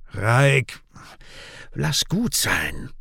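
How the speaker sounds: slow, drawn-out plea to stop; appeasing. A narrator's reading. Kian fending off his angry brother, appeasing. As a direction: tell him slowly to drop it.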